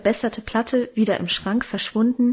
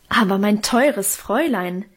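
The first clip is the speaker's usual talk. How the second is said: plain reading voice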